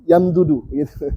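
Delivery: usual speaking voice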